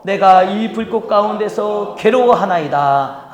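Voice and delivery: slow, measured unison reading; solemn slow scripture reading; congregational scripture reading. Shown as a demonstration. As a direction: read it slowly and solemnly together. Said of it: "다시 한번 읽어보겠습니다".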